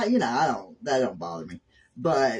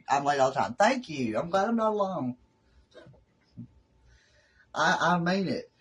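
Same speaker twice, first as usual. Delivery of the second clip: normal talking voice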